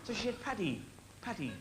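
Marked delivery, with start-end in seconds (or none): 0.4-0.8 soft Irish wife calling Paddy; Irish accent. Retold or imitated. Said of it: "How about that accent?". 1.2-1.5 softer Irish wife calling again; Irish accent. Retold or imitated. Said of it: "How about that accent?".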